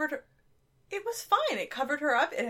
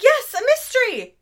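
excited; 11 semitones higher, 9 dB louder than usual. Retold or imitated. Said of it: "I was like".

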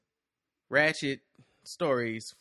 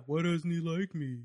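put-on cartoon girl voice, higher; Lola from Big Mouth; 3 semitones higher than usual. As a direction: imitate an odd-talking cartoon character. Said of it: "why is she talking like this".

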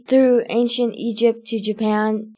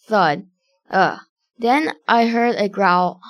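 ordinary delivery, nothing marked

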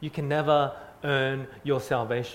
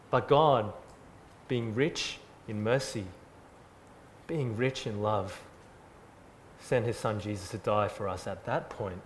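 tender; 4 semitones lower, 4 dB quieter than usual. Acted. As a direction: let the mercy land gently. Softens for God's mercy.

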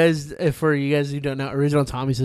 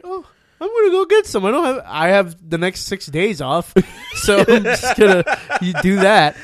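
excited; 6 semitones higher, 6 dB louder than usual. Retold or imitated. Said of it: "And I was like".

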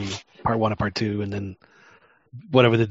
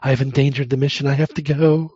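high, loud panicky whine; snivelly whiny Luke; 3 semitones higher, 5 dB louder than usual. Retold or imitated. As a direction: whine it like a frightened kid. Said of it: "snivelly little thing that he turned into".

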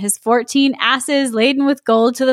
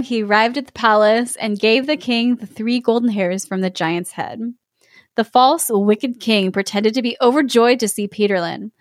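ordinary delivery

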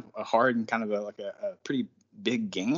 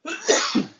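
a real rough cough; chesty cough; 4 dB louder than usual. Real, not acted. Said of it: "And I'm gonna cough right now".

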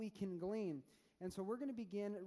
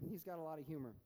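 usual speaking voice

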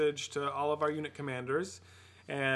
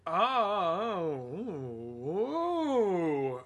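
surprised; 3 semitones higher, much slower than usual. Real, not acted. Shocked at the Palpatine hint.

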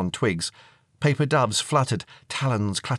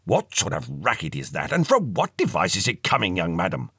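put-on old-fashioned, disapproving elder; indignant old ghost; 2 semitones lower than usual. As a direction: demand an explanation from a young madam.

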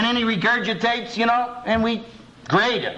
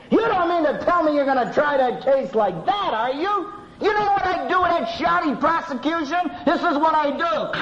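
high-pitched scornful tirade; storming professor; 9 semitones higher than usual. Retold or imitated. Law professor storms down the aisle at his students, mock outraged scorn. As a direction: tear into the students' weak case. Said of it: "come storming down ... saying".

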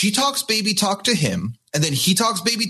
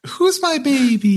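put-on high, louder cooing baby voice; baby talk; 9 semitones higher, 3 dB louder than usual. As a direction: coo to a girlfriend. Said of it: "To his girlfriend".

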